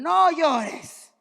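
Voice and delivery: imitating what men were told; stern order. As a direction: bark it like a strict father. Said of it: "a los hombres les dijeron".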